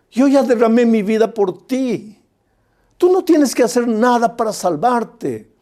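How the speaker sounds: high, loud preacher's cry as Jesus; preaching at full force. Acted. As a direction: proclaim it like a fiery pastor.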